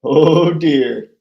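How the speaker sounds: usual speaking voice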